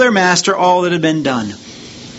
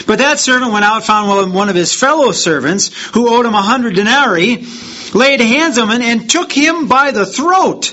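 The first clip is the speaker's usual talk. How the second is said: raised, fast scripture reading; 7 semitones higher, 4 dB louder than usual. Acted. Preacher reading the parable to the congregation, raised and fast. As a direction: read the parable out with rising fervour.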